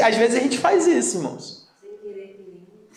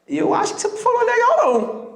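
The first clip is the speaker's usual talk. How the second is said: a touch higher, quick wife's voice; 14 semitones higher, faster than usual. Retold or imitated. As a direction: mildly voice the wife, higher and quick. Wife voiced, much higher. (esposa falando um pouco mais aguda e rápida)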